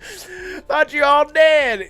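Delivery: high, loud, very slow imitation; mocking old lady. Co-hosts mimicking the trailer's old lady, slow high taunt. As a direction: drag out the taunt like a crone. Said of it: "that old lady who was just making fun of her".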